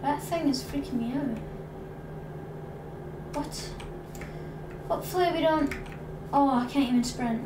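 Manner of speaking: ordinary delivery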